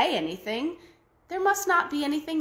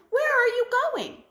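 put-on old man voice; 12 semitones higher than usual.

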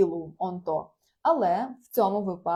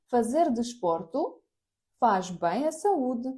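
slow model phrase; 3 semitones higher, slower than usual. Shown as a demonstration.

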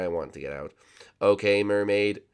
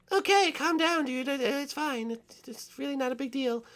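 soothing; 4 dB quieter than usual. Acted. Soothing panicked friend.

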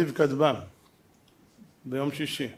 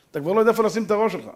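fast; much faster than usual. Shown as a demonstration.

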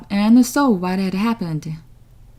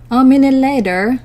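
usual speaking voice

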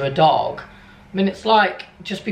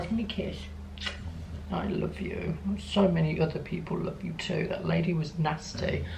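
soft, much quieter pet talk; cooing to the dog; 11 dB quieter than usual. Real, not acted. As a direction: whisper your love to the dog.

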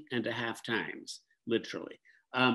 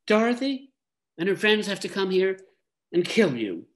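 put-on boy voice; 9 semitones higher, 6 dB louder than usual. A narrator's reading. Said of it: "he finished".

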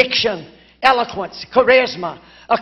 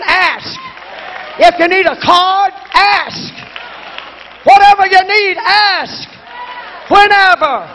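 preaching, climbing higher and louder to a shout; full-force preaching; 6 semitones higher, 5 dB louder than usual. Acted. Preacher urging the congregation to ask God big, rising shout. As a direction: drive it up like a revival preacher.

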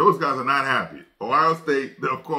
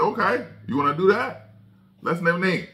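higher, challenging mock dare; retold taunt; 7 semitones higher than usual. Coach daring the league to expose others, mock taunting and high. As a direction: dare them, pitched well up.

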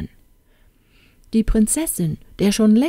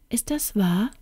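soft, higher, tremulous question; trembling voice; 4 semitones higher, 5 dB quieter than usual. A narrator's reading. Princess to the youth, seeking confirmation, trembling voice. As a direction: ask softly, hardly daring, slightly trembling. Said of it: "fragte sie mit bebender Stimme".

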